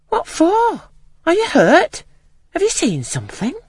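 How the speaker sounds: high, urgent questions from a worried nurse; anxious nurse demanding. A narrator's reading. Nurse demanding to know what frightened the child, anxious. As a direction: press the child for an answer. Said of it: "again demanded Bessie".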